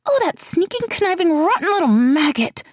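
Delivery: high, indignant, spat out in disgust; outraged friend cursing a villain; breathed insult. A narrator's reading. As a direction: hiss the insults at the absent villain. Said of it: "Celianne breathed".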